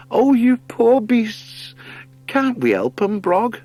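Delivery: put-on high, slow, pitying old wife's voice; tearful goodwife. Goodwife pities the sea otters, high pleading voice. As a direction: cry out in pity for the poor beasts. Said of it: "Goodwife Frutch wept".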